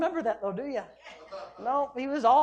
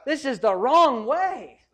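slow, louder, firm on wrong way; bold correction; 3 semitones higher, 7 dB louder, much slower than usual. Pretend. A bold friend correcting you, slow and firm. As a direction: shake them awake with it. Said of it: "shake you and tell you".

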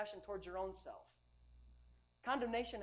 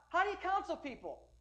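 higher, firm questioning voice; a hint of a commanding divine voice; voice of the Holy Ghost; 9 semitones higher, 5 dB louder than usual. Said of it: "authoritative voice in my spirit".

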